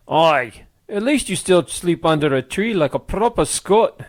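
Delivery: booming Scottish voice. A narrator's reading. Scottish uncle waking sleeping men, booming Scottish accent. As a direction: boom it out in a broad Scottish accent. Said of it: "A booming Scottish voice".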